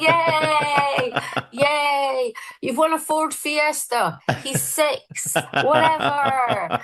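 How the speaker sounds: high, forced-chirpy presenter voice, slowing at the end; mocking her own fake cheer on kids' TV; fake cheery host. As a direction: gush like a kids' presenter then deflate to a drawn-out whatever. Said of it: "I was like".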